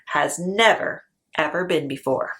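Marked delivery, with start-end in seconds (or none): none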